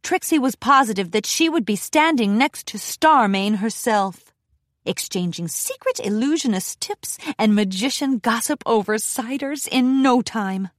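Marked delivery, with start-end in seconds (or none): none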